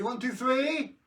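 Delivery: high, loud countdown; magic countdown. Pretend. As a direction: build to the reveal.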